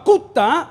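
"Cuta" with the last A stressed; stress on final A. Shown as a demonstration. Pastor naming a Samaritan god, "Cuta" stressed on its final A. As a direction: say "Cuta" slowly, landing on its final A. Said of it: "con acento en la A".

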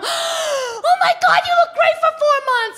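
very high, slow squealing gush; gushing stranger. Retold or imitated. Stranger gushing at fake pregnant me, high squeal. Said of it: "She's like".

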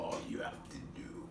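low, soft, breathy menace; Wishmaster impression. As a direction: whisper it like a sinister wish-granter. Mimics the Wishmaster line they discuss.

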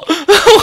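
loud high laugh of relief; real relieved laughter; burst of laughter. Real, not acted. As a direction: laugh it off in disbelief. Laughing after the play.

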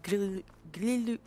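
halting French attempt at "grillou"; French pronunciation. Shown as a demonstration. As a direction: slowly try out a French word. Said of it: "Yes, in French".

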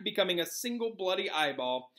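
ordinary delivery

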